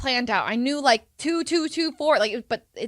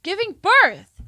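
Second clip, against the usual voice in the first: higher, louder teacher giving the answer; disappointed teacher; 10 semitones higher, 9 dB louder, much slower than usual. Retold or imitated. Teacher telling the student the answer, mock exasperated. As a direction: spell it out to the slow student. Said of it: "She's like".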